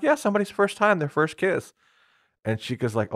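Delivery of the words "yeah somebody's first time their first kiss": put-on higher, chirpy innocent girl; naive Kaguya. Retelling the anime, imitating clueless Kaguya with a high innocent voice. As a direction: say it brightly, proud of knowing the answer. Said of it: "And she's like".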